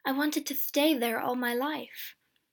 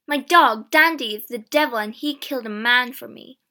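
loud, heated boast; angry; 10 dB louder than usual. A narrator's reading. James claims his killing to Mister Noel, angry. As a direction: boast angrily at full voice. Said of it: "James said angrily".

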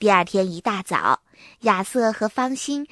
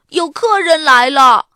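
loud, raised call to the room; 大声通报; 5 semitones higher, 11 dB louder than usual. A narrator's reading. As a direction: announce an arrival excitedly. Calls out from the window. (提高嗓门喊着通报来客)

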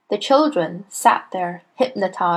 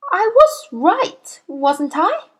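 high, slow, uncertain schoolboy; nervous; 10 semitones higher, slower than usual. A narrator's reading. Boy with the headmistress towering behind him, nervous. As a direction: ask high and timid for reassurance. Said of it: "he murmured nervously".

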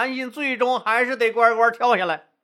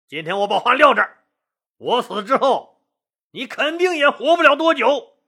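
angry scolding; 2 semitones higher, 3 dB louder than usual. Acted. Zhongli Mo, in despair, curses the friend who betrayed him, angry scolding. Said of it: "大骂韩信".

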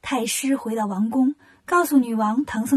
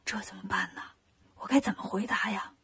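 low hushed whisper, fading quieter; secret whisper; 5 semitones lower, 11 dB quieter than usual. Acted. Tang Seng secretly whispering to Wukong for help. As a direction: whisper it so nobody hears. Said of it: "唐僧偷偷问悟空".

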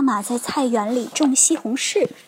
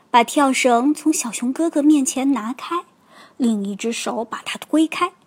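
plain reading voice

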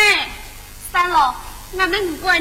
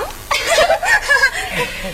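laugh; 7 semitones higher than usual. Acted. Teasing banter between siblings.

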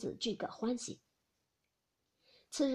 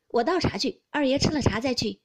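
louder, eager servant urging him to stay; hospitable servant; 3 semitones higher, 8 dB louder than usual. Acted. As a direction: press tea on the young master. Servant offers tea. (热情留客的小厮)

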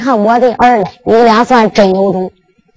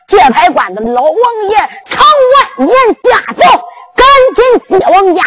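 herald's shout; 7 semitones higher than usual. Acted. Soldiers shouting that the old king has arrived. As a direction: bellow out the royal arrival. 就听军兵高喊.

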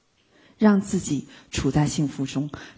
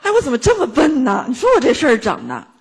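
higher, louder mock dismay at own foolishness; hammed-up self-reproach; 6 semitones higher, 5 dB louder than usual. Teacher playing a person berating herself after the insult, hammed-up. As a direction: overplay scolding yourself for being stupid.

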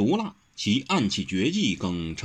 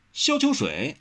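higher-pitched, prompt reply; crisp answer; 6 semitones higher, slower than usual. A narrator's reading. Wen Rou answers a quiz on famous heroes, crisply. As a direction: answer neatly without hesitation. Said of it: "温柔这下答得利落".

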